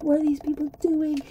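high, loud mock alarm of passengers; imitating shocked passengers; alarmed outcry. Passengers watching people jump aboard, high alarmed mock outcry. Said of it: "people inside are freaking out".

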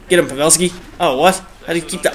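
ordinary talk, nothing marked

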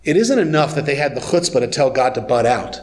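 ordinary delivery

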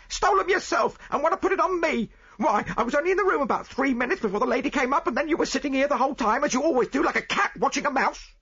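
high, loud, fast accusing voice; angry chambermaid. Acted.

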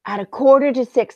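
usual speaking voice

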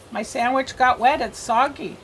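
ordinary delivery

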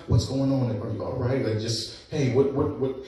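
low, soft, calming questions; soft answer. Retold or imitated. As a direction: gently ask what's wrong to calm the cops. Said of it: "a soft answer turns away wrath".

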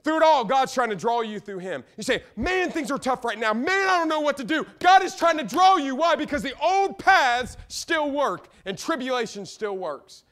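high, loud pulpit proclamation; full-force preaching. Acted.

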